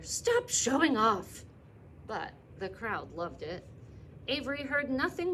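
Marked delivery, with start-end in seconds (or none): 0.0-1.4 high, loud, slow reproach; mother telling her son to quit showing off; scolding mother. Acted. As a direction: drag out the scold in exasperation. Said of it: "cried his mother".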